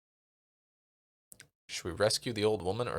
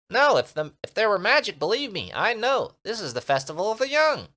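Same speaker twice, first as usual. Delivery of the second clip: high, loud little creature voice; eager little creature asking for protection; tiny Minimite creature; 13 semitones higher, 8 dB louder than usual. Acted. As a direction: squeak it out like a tiny sprite.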